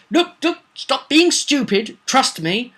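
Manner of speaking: a hint of a pleading snake priest; Costello's plea. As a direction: coax them to trust him. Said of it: "Costello says".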